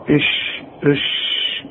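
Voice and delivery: إش said unvoiced and light; whispered letter sound; whispered sound demo. Shown as a demonstration. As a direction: breathe out a thin إش. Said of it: "لا، أنا عاوز إش".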